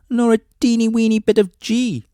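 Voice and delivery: plain reading voice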